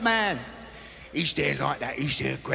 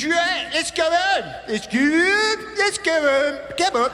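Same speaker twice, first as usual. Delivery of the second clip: hammed-up Greg calling them home; mock Greg; 7 semitones higher, slower than usual. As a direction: chivvy them home like a TV host. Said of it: "He goes".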